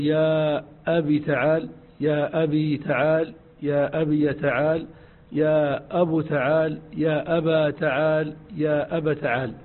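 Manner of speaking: "أبي", "أبو", "أبا" in turn; walk through each ending of أبي; forms of أبي. Shown as a demonstration. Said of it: "تقول". (أوجه "أبي" متتالية)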